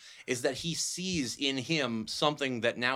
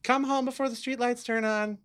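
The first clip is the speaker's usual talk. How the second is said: parent calling home; 8 semitones higher than usual. Acted.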